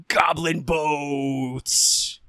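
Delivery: drawn-out, hammed-up metal holler; dramatic reveal. Storyteller revealing the goblins' new transport, hammed-up reveal. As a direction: stretch it like a heavy metal sting.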